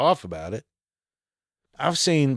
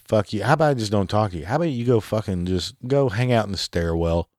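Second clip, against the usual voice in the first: ordinary talk, nothing marked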